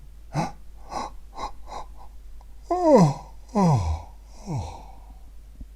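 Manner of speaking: long stretched-out yawn; big demo yawn. Shown as a demonstration. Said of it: "have a bit of a yawn".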